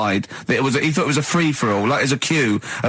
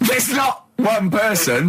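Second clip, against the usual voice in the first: high, slow exasperated shout; 8 semitones higher, slower than usual. Real, not acted. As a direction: shout the correction, drawn out in disbelief. Exasperated correction of Carl.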